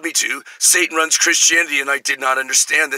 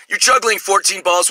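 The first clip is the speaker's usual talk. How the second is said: ordinary talk, nothing marked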